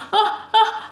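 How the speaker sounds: high, giggly oh-oh; laughing alarm. Real, not acted. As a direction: squeal lightly at your own fumble. Still fumbling, then laughs.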